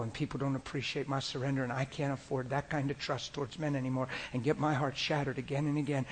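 normal talking voice

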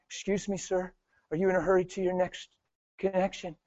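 tentative, low-volume imitation of a nervous passenger; nervous stranger. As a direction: ask timidly, barely daring to interrupt. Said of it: "they're nervous".

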